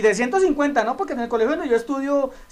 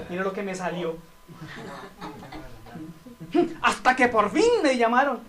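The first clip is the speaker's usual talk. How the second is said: arrogant boast; 3 semitones lower than usual. Retold or imitated. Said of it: "eso es arrogancia".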